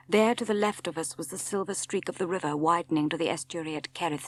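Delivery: ordinary delivery, nothing marked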